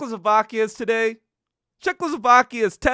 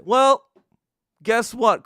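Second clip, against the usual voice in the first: ordinary delivery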